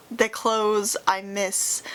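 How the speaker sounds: ordinary talk, nothing marked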